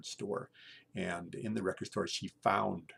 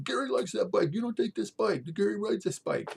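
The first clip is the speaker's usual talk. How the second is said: high, loud imitated scolding woman; a neighbor girl yelling at bike thieves, high and fierce; angry neighbor; 13 semitones higher, 7 dB louder than usual. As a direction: tell off the thieves for taking his bike. Junkie neighbor fights off bike thieves.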